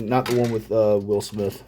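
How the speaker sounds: usual speaking voice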